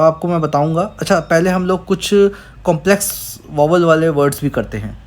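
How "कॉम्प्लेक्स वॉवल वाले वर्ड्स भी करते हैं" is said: ordinary talk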